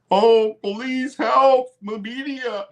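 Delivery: high, whining mock plea; mocking whiny voice. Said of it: "You're bitching and complaining".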